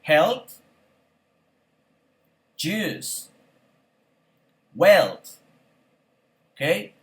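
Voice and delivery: each word said alone, then a long pause to repeat; vocabulary drill. Shown as a demonstration. Teacher reads word list for class to repeat. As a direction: give the word and wait for the echo.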